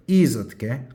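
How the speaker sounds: normal talking voice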